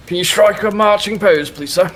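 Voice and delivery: put-on high, loud old army man; yes sir military replies. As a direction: bark it like a drill sergeant. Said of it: "and he says".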